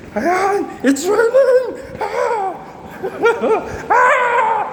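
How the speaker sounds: high, laughing cry of delight; laughing with joy. Real, not acted. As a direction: squeal and laugh as the rain comes. Rain finally starts, overjoyed.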